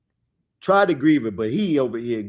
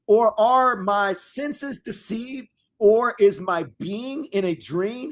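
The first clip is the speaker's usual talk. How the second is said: dramatic scripture reading; 5 semitones higher, slower than usual. Acted. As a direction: proclaim the verses slowly to the congregation.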